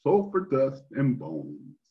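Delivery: storyteller reading a fairy tale ending; narrating the end of a fairy tale, lively and expressive; story narration. A narrator's reading. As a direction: read it out like a bedtime story. Story narration read aloud.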